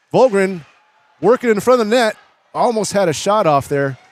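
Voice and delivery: rising, loud play-by-play burst; excited. Real, not acted. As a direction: call the chance at the net with rising excitement. Scramble at the net, live call.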